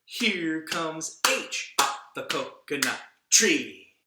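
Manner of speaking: very slow and loud, each word stretched; slow demo line. Shown as a demonstration. Puppet demonstrates another story line, very slow. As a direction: drag out the line for the gesture. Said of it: "maybe there's a sentence like".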